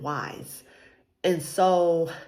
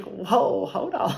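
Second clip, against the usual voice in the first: startled laugh; 6 semitones higher, slower than usual. Real, not acted. Overwhelmed by God's message.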